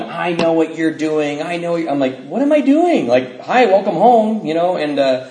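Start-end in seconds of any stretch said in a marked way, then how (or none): 0.0-1.8 mock yell, stretched out and slightly raised; retold mom yelling. Son retelling mom catching him out, mild mock yell. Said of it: "mom was yelling at me". 2.2-4.5 high, loud protest of innocence; mock indignant protest. Son acting out his reply to accusing mom, high mock protest.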